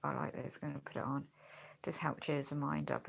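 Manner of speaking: ordinary talk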